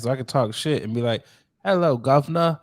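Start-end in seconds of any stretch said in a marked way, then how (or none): 1.6-2.6 lightly mocked British accent on Hello, governor. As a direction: toss off a cheeky mock British greeting. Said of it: "he got a British accent".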